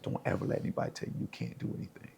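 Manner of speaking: hushed, rapid retold scolding; livid mother. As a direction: imitate a livid mother under her breath, fast. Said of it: "she was livid".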